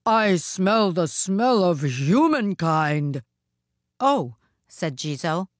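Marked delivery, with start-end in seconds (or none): 0.1-3.2 slow, loud ogre voice; drawn-out ogre proclamation; wicked Oni, crying out. A narrator's reading. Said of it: "cried the wicked Oni". 4.0-4.3 lightly voiced, higher, louder "oh"; a hint of innocent dismissal; Jizo, dismissive. Jizo covers for the woman.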